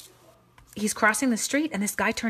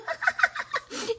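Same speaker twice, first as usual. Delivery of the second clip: laughing. Real, not acted. Laughing at husband swap joke.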